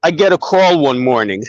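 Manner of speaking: ordinary delivery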